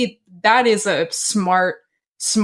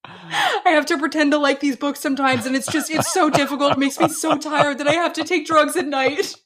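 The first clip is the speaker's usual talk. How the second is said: higher, mock-weary complaining voice with laughs; whiny podcaster; 6 semitones higher than usual. Hosts mocking self-pitying podcasters, higher mock whine. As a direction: whine about how hard podcasting is.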